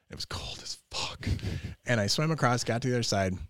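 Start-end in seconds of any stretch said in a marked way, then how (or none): 0.1-1.3 breathless hushed remark; whispered aside. Real, not acted. Telling a friend about the icy swim, whispered. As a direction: drop to a whisper for the punchline.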